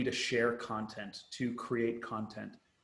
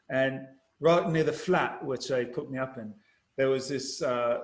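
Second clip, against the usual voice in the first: ordinary delivery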